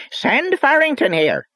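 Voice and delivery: high, piercing Northern Irish yell; furious Northern Irish shout. A narrator's reading. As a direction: bark it down the tube in a shrill Ulster accent. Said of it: "a furious voice called out in a piercing North of Ireland accent".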